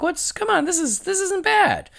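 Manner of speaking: high, put-on voice of pleased surprise; surprised. To co-hosts, imitating herself watching, in high mock amazement. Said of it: "And I'm like".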